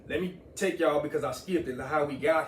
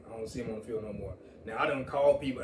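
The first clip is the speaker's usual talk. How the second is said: normal talking voice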